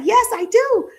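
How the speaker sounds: eager yes. Pretend. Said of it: "She's like".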